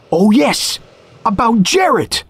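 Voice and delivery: high, airy recollection; mad stranger, suddenly remembering. A narrator's reading. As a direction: pipe up as if just reminded.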